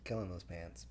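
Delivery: low, sly backhanded delivery; backhanded compliment. Retold or imitated. Retelling the guy's snide remark about her pants, backhanded. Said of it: "that's the way he said it".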